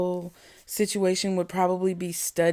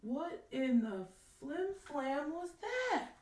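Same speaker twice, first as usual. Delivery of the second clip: startled hush; 7 semitones higher, 15 dB quieter than usual. Real, not acted. Hears a crash mid-video.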